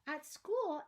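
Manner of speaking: plain reading voice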